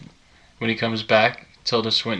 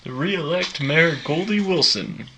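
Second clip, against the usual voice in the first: slow, high campaign chant; campaign slogan voice; 6 semitones higher, slower than usual. Retold or imitated.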